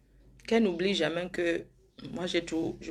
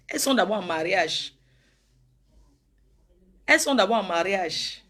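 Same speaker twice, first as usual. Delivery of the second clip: talking loud; 5 dB louder than usual. Acted. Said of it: "excuse-moi de parler fort là".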